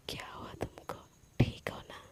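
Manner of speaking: breathy whisper close to the neck; soft whisper. A narrator's reading. Sana whispers to Palak while hugging her from behind. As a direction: whisper it softly into her ear. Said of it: "धीरे से बोलती है".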